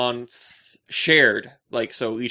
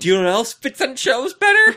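smug comeback; 13 semitones higher, 9 dB louder than usual. Retold or imitated. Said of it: "And I'm like".

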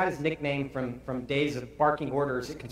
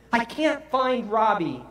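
raised, high-pitched cry of a frantic mother; panicked shout; 8 semitones higher, 5 dB louder than usual. Retold or imitated. Frantic wife to husband about their missing baby, shouting. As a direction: call it out loud and high. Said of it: "she shouted".

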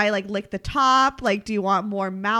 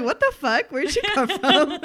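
laughing imitation; 6 semitones higher, 4 dB louder than usual. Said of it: "in an Australian accent".

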